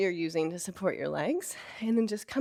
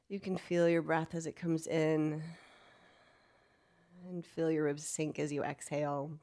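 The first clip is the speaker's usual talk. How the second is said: guided meditation; 6 dB quieter than usual. Acted. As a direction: ease them into stillness quietly. Leads a soft meditation.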